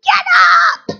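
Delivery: high, loud cheering shout; urging a beaten-up Superman, loud shout; yelling at the TV. Retold or imitated. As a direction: yell it at the screen for the hero. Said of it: "and I'd be like".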